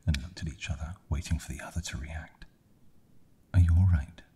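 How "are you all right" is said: louder, urgent call of concern; concerned question. A narrator's reading. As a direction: ask it loudly, reaching out to check on them. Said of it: "she asked".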